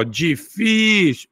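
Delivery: "difícil" said slowly with "fí" drawn out; stress on middle syllable. Shown as a demonstration. Teacher repeating the example, "difícil" with long stressed "fí". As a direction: hold the "fí" of "difícil". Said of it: "eu tenho uma paroxítona".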